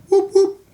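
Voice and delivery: high, loud siren whoops; two short whooped bursts like a squad car; imitated police siren. Teller announcing the feds' arrival, high mock siren.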